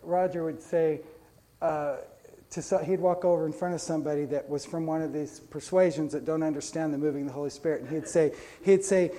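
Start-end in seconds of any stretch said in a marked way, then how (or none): none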